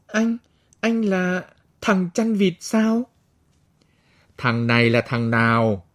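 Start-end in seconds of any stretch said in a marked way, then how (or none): 0.1-3.1 higher, slow astonished question; surprised. A narrator's reading. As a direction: gasp in disbelief. Astonished recognition of friend.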